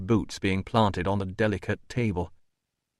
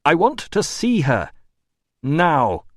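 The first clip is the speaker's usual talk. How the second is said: raised, loud insistence; forceful demand; 6 semitones higher, 6 dB louder, slower than usual. A narrator's reading. Doctor cutting off the administrator, loud demand. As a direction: demand it, with "Now" set apart.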